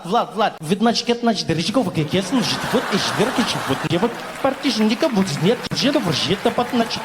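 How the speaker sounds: fast mock Russian gibberish. Mocking Russian as a DJ scratching in a Russian accent. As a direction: scratch through fake Russian like a turntable. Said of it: "DJ is scratching English".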